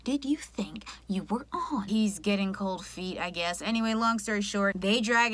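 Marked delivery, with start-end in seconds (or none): 0.0-1.9 slow unvoiced whisper at the TV; hushed aside, incredulous; mock whisper.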